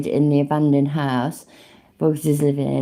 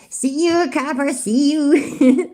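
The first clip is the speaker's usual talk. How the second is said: much higher, stretched mock American accent; 9 semitones higher, slower than usual. Streamer mocking the cop in a bad American accent, slow.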